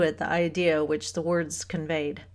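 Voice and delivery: plain reading voice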